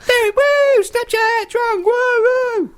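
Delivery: very high, loud whoops; drunk party whooping. Pretend. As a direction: cheer like drunk bandmates. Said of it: "they're like".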